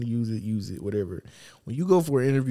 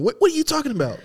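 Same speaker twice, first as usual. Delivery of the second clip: high, louder mock disbelief; incredulous rebuff; 13 semitones higher, 5 dB louder, faster than usual. Owner rebuffs employee bringing coffee, high incredulous mock rebuff. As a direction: shoot it back baffled, pitch jumping.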